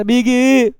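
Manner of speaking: बिगी stretched into two long beats; wrongly split word. Shown as a demonstration. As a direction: say बिगी as a drawn-out 'bee-gee'. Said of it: "नहीं बोलना".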